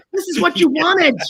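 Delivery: high, loud retort through amusement; mock exasperated yell. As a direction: throw it back at them, loud and high. Acted exasperated reply to subjects.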